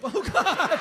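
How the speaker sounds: ordinary talk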